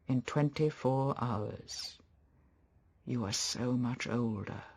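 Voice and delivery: quiet, halting regret; regretful husband. Acted. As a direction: grieve softly for what is lost, letting phrases hang. Maxim mourns his wife's lost youth.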